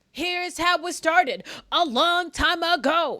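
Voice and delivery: loud, high, slow Scottish storytelling; Scottish accent. Acted. Streamer imitating Scottish friend, loud Scottish accent. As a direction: declaim it like a Scot. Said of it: "Reminds me of my Scottish friend".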